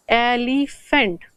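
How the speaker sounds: "एलिफेंट" drawn out slowly, opening vowel clear; slow demo word. Shown as a demonstration. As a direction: say "एलिफेंट" slowly so the starting vowel sound is heard. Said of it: "जब मैं बोल रही हूँ एलिफेंट". ("एलिफेंट" धीरे से, शुरू की स्वर ध्वनि साफ़)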